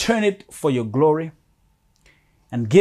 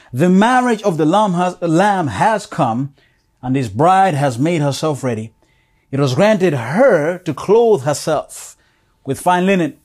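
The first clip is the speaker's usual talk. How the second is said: fervent scripture reading; 2 semitones higher, 5 dB louder than usual. Acted. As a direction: proclaim the verses to the congregation.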